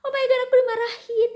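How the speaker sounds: loud, high "Oh my God" of shock; shocked dismay. Retold or imitated. Recalling her reaction to being scolded on the bus, loud and high. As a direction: cry out as if just scolded. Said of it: "Aku habis itu kayak".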